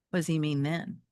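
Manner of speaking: usual speaking voice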